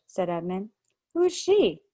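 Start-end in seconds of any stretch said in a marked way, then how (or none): 1.1-1.8 higher, lightly voiced boy asking; curious boy. A narrator's reading. Edmund asking Lucy about the witch, lightly voiced boy. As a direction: ask it with sudden interest.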